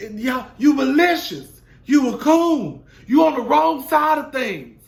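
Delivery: high, loud imitation of her yelling; mock yelling woman. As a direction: ham up her hollering at the cast, loud and high. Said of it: "hollering and screaming".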